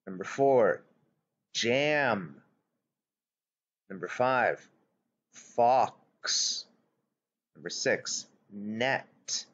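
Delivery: slow, deliberate word list with long pauses; dictation read. Acted. Teacher dictating worksheet words to young pupils. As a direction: read each word clearly for the children to write.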